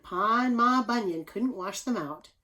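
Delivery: ordinary talk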